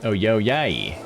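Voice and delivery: ordinary talk, nothing marked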